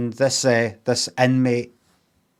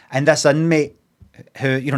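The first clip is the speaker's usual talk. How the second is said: ordinary talk, nothing marked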